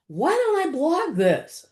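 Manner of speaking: musing to self. Retold or imitated. Retold thought, idea dawning.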